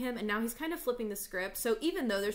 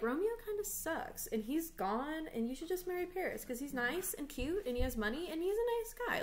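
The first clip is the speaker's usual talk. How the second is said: a light, much higher gushing nurse voice; chirpy nurse; 8 semitones higher than usual. Acted. Nurse urging Juliet to marry Paris, in a lightly voiced gushing tone. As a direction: sell Paris like a cheerful busybody. Voicing the nurse's modern paraphrase.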